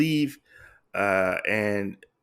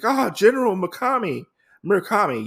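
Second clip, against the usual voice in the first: higher-pitched mock exclamation as the lady; imitated lady; 9 semitones higher than usual. Lady Asano greets General Murakami, imitated high exclamation. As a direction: play-act a lady hailing her general.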